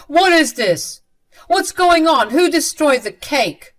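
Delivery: loud, high angry questioning; angry demand. A narrator's reading. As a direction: shout at them, furious about the ruined cake. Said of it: "Leo angrily said".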